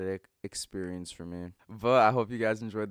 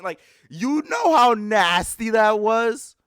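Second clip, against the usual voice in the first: voice crack; 11 semitones higher, 10 dB louder, slower than usual. Real, not acted. Reliving the disgust for viewers, voice cracking high. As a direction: voice cracks up high, loud and slow. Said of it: "Voice crack".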